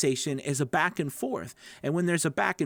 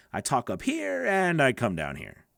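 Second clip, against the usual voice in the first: wide pitch range. Shown as a demonstration. Coach showing learners a wide range, pitch swooping up then down. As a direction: pitch climbing on "up here", falling on "down here". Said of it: "I have a wide pitch, a wide range".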